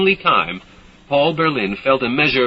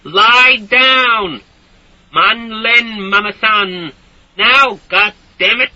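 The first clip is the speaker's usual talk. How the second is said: furious shouting; 10 semitones higher, 6 dB louder, slower than usual. A narrator's reading. Enraged soldier.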